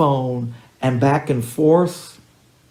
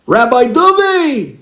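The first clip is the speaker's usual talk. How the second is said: a high, loud, stretched-out call across the street; kid screaming; 13 semitones higher, 9 dB louder, slower than usual. Retold or imitated. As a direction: yell his name to get his attention. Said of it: "one kid screams".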